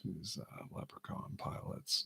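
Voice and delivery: ordinary talk, nothing marked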